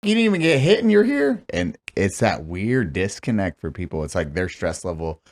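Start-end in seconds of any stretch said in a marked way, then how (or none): 0.0-1.4 loud, high disbelieving yell; incredulous outburst. Retold or imitated. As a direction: shout it in outraged disbelief. Indignant retold reply, loud high.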